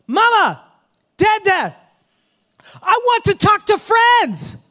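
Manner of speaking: put-on high shouting toddler; child yelling. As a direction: yell like a demanding toddler. Toddler demanding at bedtime.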